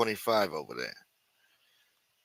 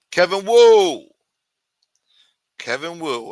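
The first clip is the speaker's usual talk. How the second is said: high, loud shout-out; name call-out; 7 semitones higher, 11 dB louder, slower than usual. Real, not acted. Host greeting viewer Kevin Wu, loud. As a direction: announce him like a ring announcer.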